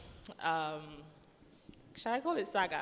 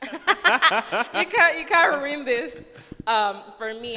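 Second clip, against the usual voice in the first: laughing repeat; 8 semitones higher, 14 dB louder, much slower than usual. Retold or imitated.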